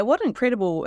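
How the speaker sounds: ordinary delivery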